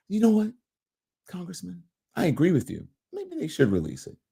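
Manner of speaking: softly measured, conceding reply; hypothetical interviewer. Pretend. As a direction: play the interviewer gently agreeing. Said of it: "If I was Jim, I'd say".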